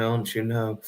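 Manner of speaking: ordinary talk, nothing marked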